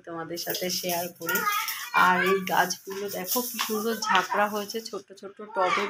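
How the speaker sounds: ordinary talk, nothing marked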